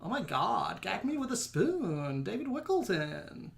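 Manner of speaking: drawn-out Los Angeles Valley-speak, softly put on; slow, hammed-up Los Angeles twang. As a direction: stretch the words in a mock LA accent. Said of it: "do a Los Angeles voice".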